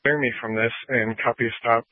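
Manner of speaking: ordinary talk, nothing marked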